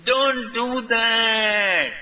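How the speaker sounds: high, slow, loud cry; shouted. A narrator's reading. Friends crying out as Krishna goes to jump, loud. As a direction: yell it out in alarm. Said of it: "all friends shouted".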